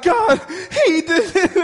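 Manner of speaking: high, loud mock-crying plea; hammed-up tearful complaint; tattling wailing child. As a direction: wail it like an upset toddler.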